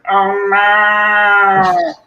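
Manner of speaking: loud, high, long-held "oh no"; drawn-out stage wail. Retold or imitated. Actor re-enacting an old inside-joke line for friends, hammed-up wail. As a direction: belt it out as one sustained, theatrical cry.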